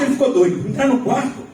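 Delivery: usual speaking voice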